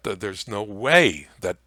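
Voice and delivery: ordinary talk, nothing marked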